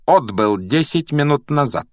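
icy schoolmistress, measured pace; cold. A narrator's reading. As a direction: inform them coolly and deliberately. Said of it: "холодно произнесла профессор МакГонагал".

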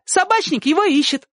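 very high, excited call; joyful shout. A narrator's reading. Sonka adding news for neighbour, shouting gleefully. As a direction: pipe the news out eagerly. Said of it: "радостно крикнула Сонька".

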